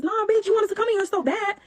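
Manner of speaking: retold taunt shouted mid-fight; angry shout. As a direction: yell it down at her. Yelling at the neighbor mid-fight.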